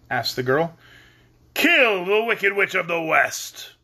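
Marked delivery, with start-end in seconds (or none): 1.5-3.7 slow, higher, drawn-out order; commanding great head. A narrator's reading. Great head setting the girl's task, commanding. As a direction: stretch it out as a grand command.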